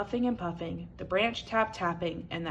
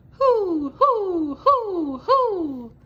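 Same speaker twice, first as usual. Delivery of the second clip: high, loud spooky owl hoo; owl hooting; 12 semitones higher, 9 dB louder, much slower than usual. A narrator's reading. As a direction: hoot like an owl in the night. Owl calling in the night.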